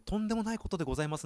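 大げさに丁寧な言い方; 上品ぶってかしこまる; grandiose polite. Shown as a demonstration. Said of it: "大行な感じがして". (大げさな敬語口調)

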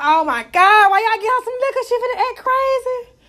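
high-pitched mock dismay; exasperated cousin. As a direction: wail it like a cousin dreading a scene. Cousin exclaims in dismay, acted out.

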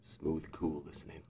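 low, soft, slow radio DJ croon; velvet voice. Acted. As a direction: purr it like a smooth late-night DJ. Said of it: "my Luxembourg Velvet voice".

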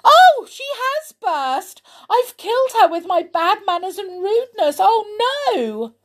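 high-pitched storybook wail of horror; screaming. A narrator's reading. Amelia thinks she has killed Missus Goodmanners, screaming. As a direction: shriek in panic, easing to a dismayed "no". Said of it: "Amelia Jane gave a scream".

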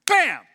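sudden high, very loud impact noise; explosive bam. Acted. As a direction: burst out with the strike. Sudden snake bite in story.

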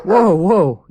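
sudden loud, high whoa; urgent calming. Acted. Shelter worker steadies a startled dog, urgent and loud. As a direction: rein in a startled animal sharply.

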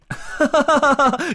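loud rising laugh of mischief; high triumphant laugh. Acted. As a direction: burst out laughing at the trick. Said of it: "艾玛就已经大笑着跳出来了".

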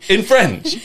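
mock French accent. Shown as a demonstration. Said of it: "unless you say it".

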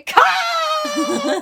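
high, drawn-out hammed-up wail; loud cry. A narrator's reading. Indian gentleman cries out to Carmichael, long loud wail. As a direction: stretch the name into a long shout. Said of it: "cried out loudly".

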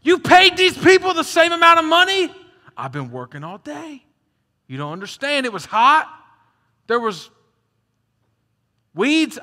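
loud, high, indignant worker griping; frustrated complaint. Retold or imitated. Worker complains to landowner, loud and indignant. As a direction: protest the unfair pay at full voice. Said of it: "These guys are frustrated".

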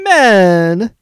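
high, loud, drawn-out mock shout; Johnny Horn. Host taking a turn at a character's random shout, high drawn-out yell. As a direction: holler it out, stretching the first vowel. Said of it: "Johnny Horn randomly shouts".

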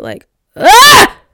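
loud, high frustrated scream into the mic; real scream. Real, not acted. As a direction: let out a raw scream of frustration. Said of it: "I had to scream into the mic".

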